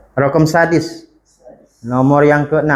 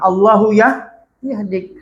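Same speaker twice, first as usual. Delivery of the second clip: mock angry prayer; 7 semitones higher, slower than usual. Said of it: "Dia doakan".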